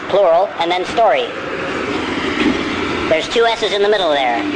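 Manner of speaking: ordinary talk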